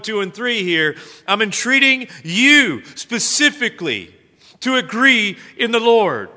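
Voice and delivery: loud, high, insistent one-on-one appeal; single them out and press each word home; cornering entreaty. Acted. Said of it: "he's got Euodia in the corner saying".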